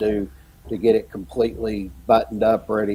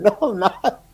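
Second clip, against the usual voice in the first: laughing denial; 10 semitones higher than usual. Retold or imitated. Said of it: "I tried to keep from laughing".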